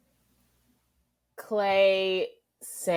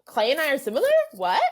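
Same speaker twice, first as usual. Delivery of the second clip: mock disbelief; 9 semitones higher, 5 dB louder, faster than usual. Retelling her own disbelief.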